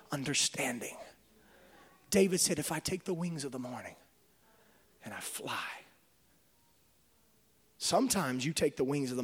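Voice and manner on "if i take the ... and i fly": softer and lower, fading to a hush. Acted. Preacher quoting David, fading into a hushed voice. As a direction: let the verse fall away to a whisper.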